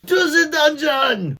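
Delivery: a loud, very high, slow cry; cried out. Acted. Mouse in the crowd crying out for the dungeon. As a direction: cry out for punishment. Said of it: "a voice cried out".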